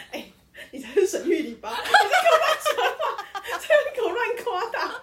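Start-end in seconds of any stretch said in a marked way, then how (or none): 1.8-5.0 playful scolding. Real, not acted. Teasing scold of partner.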